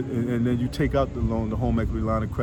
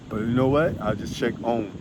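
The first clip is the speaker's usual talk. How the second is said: ordinary talk, nothing marked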